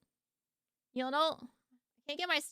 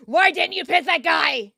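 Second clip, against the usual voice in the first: loud, higher robotic yell at the driver; a text-to-speech voice yelling a question; mock screaming GPS voice; 4 semitones higher, 11 dB louder than usual. Shown as a demonstration. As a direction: scream it like an angry navigation voice. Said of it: "how do you scream as T T S".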